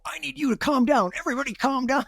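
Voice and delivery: very high, anxious plea to calm down; mock a panicked boss begging everyone to calm down; frantic calm down. Boss walking in, begging everyone to calm down in a very high, frantic voice. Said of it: "Well, clearly you're not calm".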